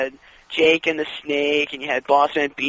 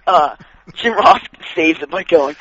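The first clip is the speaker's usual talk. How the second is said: usual speaking voice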